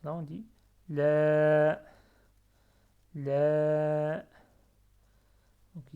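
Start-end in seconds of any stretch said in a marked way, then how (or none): 0.9-4.2 "la" très fort, voyelle longuement tenue; prolongé. Shown as a demonstration. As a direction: tenir le a de "la" sur une note égale. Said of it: "il sert à prolonger". (« la » étiré avec force)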